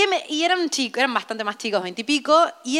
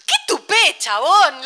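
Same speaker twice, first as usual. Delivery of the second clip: indignant retort; 3 semitones higher, 4 dB louder, much slower than usual. Retold or imitated. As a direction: throw it back at him. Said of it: "le dijo". (réplica indignada de la amiga)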